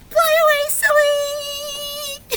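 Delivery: playful high silly voice. Shown as a demonstration. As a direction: squeak it out silly.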